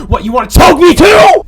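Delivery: loud, high challenge; hammed-up angry outburst; confrontational shout. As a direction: yell a dare at unseen voices. Challenging the mysterious voices.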